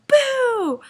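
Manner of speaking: sudden loud, high "boo"; mock startle shout. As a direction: leap out and yell to scare someone. Jumps out to scare her.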